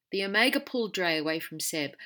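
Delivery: ordinary delivery, nothing marked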